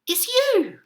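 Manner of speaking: very high, gleeful reveal; amused exclamation. A narrator's reading. Duckbills explaining the reflection, amused exclamation. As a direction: pipe it up, as if tickled. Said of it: "And they laughed and said".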